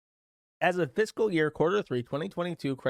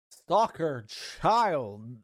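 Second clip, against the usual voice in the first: mocking drawn-out label; much slower than usual.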